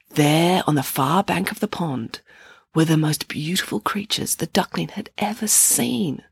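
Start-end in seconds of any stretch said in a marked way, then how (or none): none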